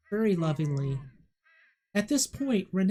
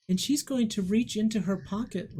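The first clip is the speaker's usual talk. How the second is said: ordinary talk, nothing marked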